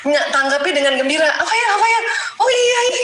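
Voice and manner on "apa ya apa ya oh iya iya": much higher, bubbly delighted chirp; gleeful reply. Retold or imitated. Wife answers her husband's funny story with delighted high squeals. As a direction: squeal back happily like a charmed wife. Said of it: "saya langsung gembira aja gitu".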